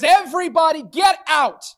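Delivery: loud, high order to clear the room; a driving shout at the money changers; commanding shout. Retold or imitated. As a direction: bellow them out of the temple. Jesus chasing people from temple.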